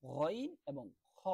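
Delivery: slow stretched গইন এবং খ from the back of the throat; drawn-out Arabic letters. Shown as a demonstration. Teacher demonstrating throat letters, slow and drawn out. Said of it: "মাখরাজ হলকের শেষ হইতে".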